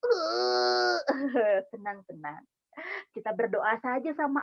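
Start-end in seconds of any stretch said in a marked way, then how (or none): 0.0-1.0 drawn-out pretend sob; hammed-up crying noise; mock sad wail. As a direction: bawl playfully, as if very sad. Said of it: "sedihnya sedih banget".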